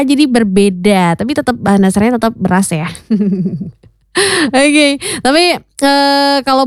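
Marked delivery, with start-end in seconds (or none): 2.9-3.7 short light laugh; a brief chuckle to cap the remark; easy little laugh. Real, not acted. Light joking radio chat.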